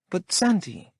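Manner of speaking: woman begging her man; Lola pleading. A narrator's reading. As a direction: whine his name.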